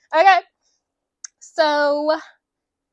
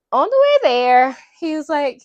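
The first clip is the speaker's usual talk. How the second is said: ordinary delivery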